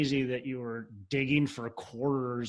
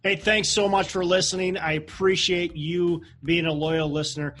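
upbeat outro read; 4 semitones higher, 4 dB louder than usual. Acted.

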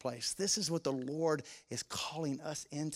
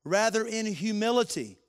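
reading scripture, swelling louder and higher; building scripture reading; 5 semitones higher, 5 dB louder than usual. Acted. Pastor reading Philippians to the congregation, building to fervent preaching. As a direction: preach the passage with rising fervour.

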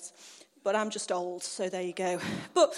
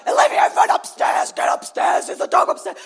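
loud, urgent re-enacted yelling; panicked orders; 3 semitones higher, 7 dB louder than usual. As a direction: send a child off searching in a panic.

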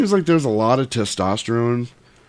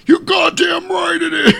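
very high, loud cracked shout; mock fury; 6 dB louder than usual. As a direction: scream it in exaggerated outrage. Mock outburst, then just kidding.

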